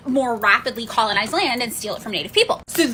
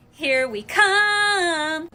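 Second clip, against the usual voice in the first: mock settlers; 4 semitones higher, much slower than usual. Settlers heading to California.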